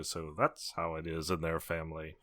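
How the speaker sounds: low, soft deadpan quote; quoting aside. Acted. Turning to Olivia after someone acts weird, low quiet aside. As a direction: drop it low like a private aside. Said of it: "I'll just kinda turn to Olivia and go".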